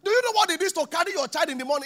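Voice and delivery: loud, high, fast challenge; fervent preaching. Real, not acted. Preacher demanding an answer from the church, loud and fast. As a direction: press the question hard on the crowd.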